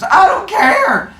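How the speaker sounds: very high, loud, slow stretched yell; impatient yell. Retold or imitated. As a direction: stretch every word in a loud shout. Zeus impatient.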